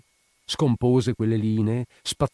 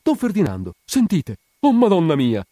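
high, loud, panicked appeal; Peppino's frightened plea; 9 semitones higher, 7 dB louder than usual. A narrator's reading. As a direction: plead as the attacker lunges at you. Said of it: "Peppino il Sarto balbettò". (supplica spaventata, voce acuta)

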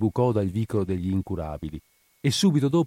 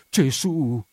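higher, shocked exclamation; 5 semitones higher than usual. A narrator's reading. Widow hearing Spina's confession, shocked exclamation. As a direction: cry out in pious dismay. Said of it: "esclamò la vedova".

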